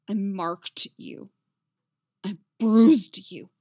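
slow self-disgusted confession, building loud; full of disgust. A narrator's reading. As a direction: slowly spit out each admission. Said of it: "voice full of disgust".

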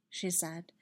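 plain reading voice

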